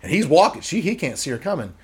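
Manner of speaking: usual speaking voice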